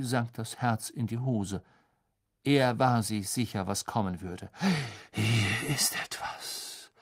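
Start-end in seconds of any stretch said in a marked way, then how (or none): 5.1-6.9 slow, hushed mystic whisper; seer's slow whisper. Acted. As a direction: breathe it out slowly into the crystal. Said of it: "flüsterte Professor Trelawney".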